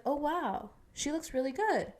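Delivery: light mock wow as if caught off guard; impressed gasp. She mimics her own reaction to a YouTuber.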